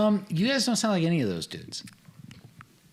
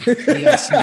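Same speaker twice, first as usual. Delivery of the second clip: laughing imitation; 11 semitones higher, 11 dB louder, slower than usual. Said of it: "when he said".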